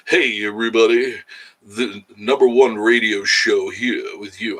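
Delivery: loud, slowed announcer greeting; radio host voice. Acted. Streamer showing the persona he avoided, mock radio voice. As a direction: put on a mock DJ intro. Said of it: "Where's your radio voice?".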